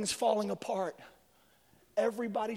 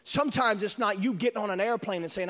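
ordinary delivery

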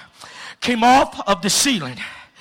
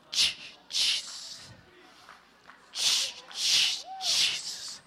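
frozen lips barely getting the name out; whispered stammer, straining; struggling whisper; 11 dB quieter, slower than usual. Retold or imitated. Retelling how he could barely whisper the name. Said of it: "I couldn't say it with my lips".

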